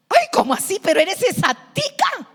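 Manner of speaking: high, loud, astonished exclamation; surprised. Retold or imitated. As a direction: squeal it out in disbelief. Friend shocked at revealed identity.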